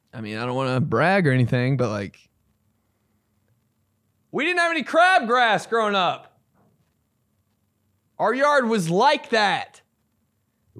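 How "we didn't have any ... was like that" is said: high-pitched, showy boast; mock bragging. Mock boast after denying bragging.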